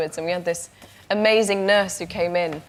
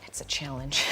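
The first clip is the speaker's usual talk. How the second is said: low, hushed whisper, staying positive; 6 semitones lower, 7 dB quieter than usual. Retold or imitated. Tom Cruise whispering back to her, low and positive. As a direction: whisper the upbeat line under your breath. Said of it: "he goes".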